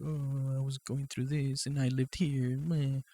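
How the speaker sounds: mock his moaning lightly; whiny complaint. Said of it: "he was complaining again".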